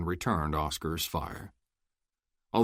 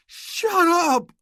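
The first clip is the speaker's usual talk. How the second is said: furious roar, much higher and louder; 9 dB louder than usual. Acted. Oscar roars at Luke, furious. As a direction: bellow it with rage. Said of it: "Oscar roared".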